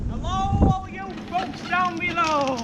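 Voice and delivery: high, loud holler to people below; playful calling out. Pretend. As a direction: hail a crowd from up high.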